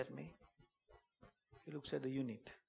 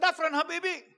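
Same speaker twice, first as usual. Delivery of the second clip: loud reply; 13 semitones higher, 12 dB louder than usual. Retold or imitated. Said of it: "I said".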